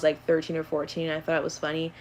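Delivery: ordinary talk, nothing marked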